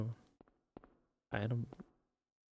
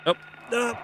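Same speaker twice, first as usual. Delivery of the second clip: startled cry; 11 semitones higher than usual. Real, not acted. Startled by enemy in game.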